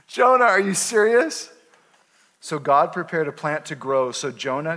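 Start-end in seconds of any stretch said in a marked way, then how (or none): 0.1-1.5 laughing disbelief. Real, not acted.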